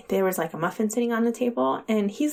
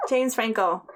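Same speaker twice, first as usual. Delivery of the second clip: ordinary delivery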